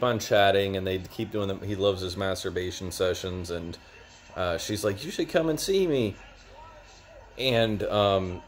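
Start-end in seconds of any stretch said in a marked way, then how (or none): 5.0-6.2 high sweet imitation of the girl; high teasing girl voice; mock girly voice. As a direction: put on a lightly girlish invite. Girl voiced in retold story.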